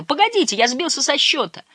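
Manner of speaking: high, urgent protest; crying out. A narrator's reading. Uncle losing count as he is hoisted, high cry. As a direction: cry out high to make them wait. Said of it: "крикнул дядя Солтерс из-за борта".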